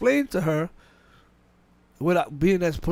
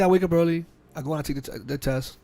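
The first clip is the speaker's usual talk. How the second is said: ordinary talk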